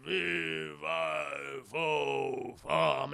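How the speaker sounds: slow, rumbling giant impression; drawn-out monster chant; giant sand golem. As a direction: boom it out like a lumbering golem. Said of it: "he's become this giant sand golem".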